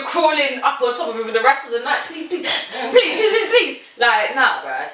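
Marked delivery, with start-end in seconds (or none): 2.1-3.8 high, pleading repeats; mock begging. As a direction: beg over and over, pitched up, laughing at the end. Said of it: "And you're like".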